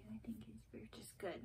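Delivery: whispered aside to herself; talking to herself in a whisper. Real, not acted. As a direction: breathe it under your breath. Muttering to herself.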